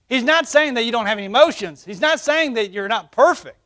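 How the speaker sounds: high, loud preacher's cry; full-force preaching. Acted. Pastor preaching to the church, ringing out.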